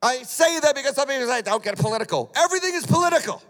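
loud, high-pitched fervent preaching; preaching at full force. Acted. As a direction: preach it to the congregation with fire.

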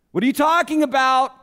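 normal talking voice

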